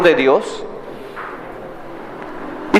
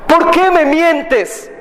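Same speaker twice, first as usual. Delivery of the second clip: slow, higher angry demand; king furious at the prophet, slow and pitched up; 9 semitones higher, much slower than usual. Acted. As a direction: press him hard for the truth, slowly. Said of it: "muy enojado le dice".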